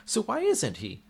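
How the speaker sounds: usual speaking voice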